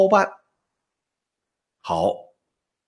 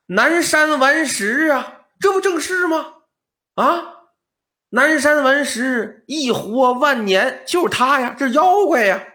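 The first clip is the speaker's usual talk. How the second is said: excited high exclaim; 7 semitones higher than usual. Acted. Relatives exclaim about demon.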